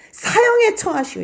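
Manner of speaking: sharp cry for the death sentence; crowd shouting. Acted. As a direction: shout it like an angry mob demanding execution. Crowd demands Jesus' death. (사형을 외치는 군중의 고함)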